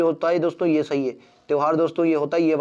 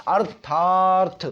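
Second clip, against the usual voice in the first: "अर्थार्थ" very slow, arth-thaar-th in separate pieces; slow demonstration; much slower than usual. Shown as a demonstration. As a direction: stretch the long aa in "अर्थार्थ". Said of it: "देखो बोलो अर्थार्थ".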